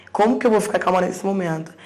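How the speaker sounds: retold agitated protest. Distraught mother answering the doctor who asks for calm, agitated protest. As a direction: throw the question back loudly, reliving it.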